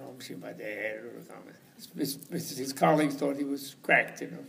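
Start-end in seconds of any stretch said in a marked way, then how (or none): 0.1-1.4 low, very soft mantra mutter. Retold or imitated. Monk marches into the jungle, muttering his mantra. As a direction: mumble the mantra under your breath. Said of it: "marched off in the jungle muttering".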